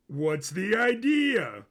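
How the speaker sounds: an eager prince stretching the question; high slow Sisko voice. A narrator's reading. Narrator voicing Sisko asking his cousin about the plan, high, loud and slow.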